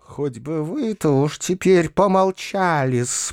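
slow, spiteful hiss. A narrator's reading. Perepelitsyna tells uncle to be silent, hissing. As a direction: hiss the reproach, drawing it out. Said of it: "прошипела Перепелицына".

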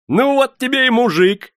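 very high, loud, cheerful cry; merry exclamation. A narrator's reading. Prokofy cheerfully settles the girl's match, merrily. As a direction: pronounce it with loud glee. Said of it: "весело отрезал Прокофий".

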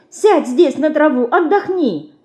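high-pitched remorseful urging. A narrator's reading. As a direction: coax him to rest in a high voice. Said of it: "с раскаянием бормотала она".

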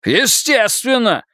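furious man barks at his mistress; angry bark. Acted. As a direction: bark it out beside himself. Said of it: "вне себя рявкнул Бергдорф".

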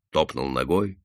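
ordinary delivery, nothing marked

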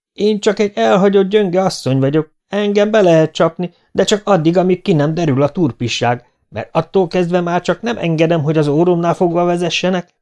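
high-pitched indignant tirade; dühös asszony. A narrator's reading. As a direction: scold him as a wronged woman. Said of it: "nyelvelt a dühös asszony". (felháborodott, magas hangú nyelvelés)

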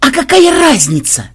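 high, loud outraged question; yelled retort. A narrator's reading. Ксен yells back at Мелисса's excuse, outraged. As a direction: shriek back in indignation. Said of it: "завопил в ответ Ксен".

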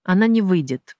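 usual speaking voice

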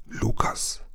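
hushed whispered call. A narrator's reading. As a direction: whisper the name cautiously to a companion. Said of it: "wisperte er". (geflüstert)

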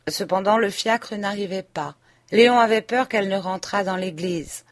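ordinary delivery

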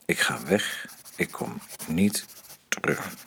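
softly whispered, almost gone; whispered farewell. Acted. Man writing farewell letter to his friend Max, whispered. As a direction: breathe it out like a last goodbye.

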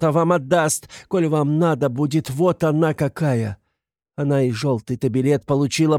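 high, impassioned plea; crying out. A narrator's reading. Katerina telling everyone of Sonya's sacrifice, impassioned cries. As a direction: cry out her praise in anguished pride. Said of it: "кричала она".

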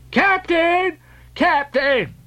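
high frantic yell of a bumpkin; panicked high yell for the captain. A narrator's reading. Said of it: "yelled the bumpkin".

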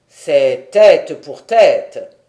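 loud, slow and unbending; a chief's harsh verdict, slowly; stern pronouncement. A narrator's reading. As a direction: lay down the law, word by word. Chief states harsh law.

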